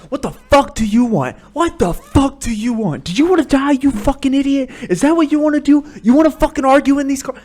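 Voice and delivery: loud, high-pitched road-rage tirade; furious yelling. Acted. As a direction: scream challenges at another driver. Acted screaming at other driver.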